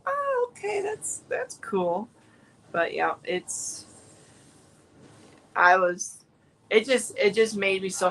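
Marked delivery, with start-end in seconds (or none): 0.1-2.1 mock surprised "oh okay", pitched way up; imagined viewer catching on. Viewer realising it's easy, mock surprised. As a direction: play a viewer getting it, amused. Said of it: "You'll be like".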